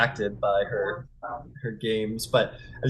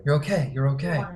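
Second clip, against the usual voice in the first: lightly raised, calming reassurance; soothing; 6 semitones higher than usual. Acted. Basan calming the freed man. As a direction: settle him gently. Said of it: "he goes".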